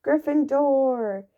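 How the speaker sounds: slow, drawn-out shout; hat's shout. A narrator's reading. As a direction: announce the house to the hall. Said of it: "the hat shouted".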